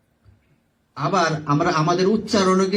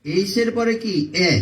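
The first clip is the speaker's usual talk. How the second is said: usual speaking voice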